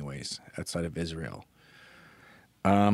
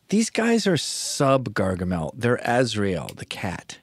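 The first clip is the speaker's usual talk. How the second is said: ordinary talk, nothing marked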